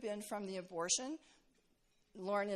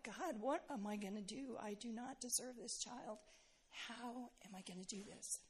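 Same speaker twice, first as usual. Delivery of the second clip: softer, pleading to God; anxious plea; 10 dB quieter than usual. Retold or imitated. New mother holding her daughter, hushed anxious plea to God. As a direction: let the question fall hushed and lost. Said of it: "And I'm like".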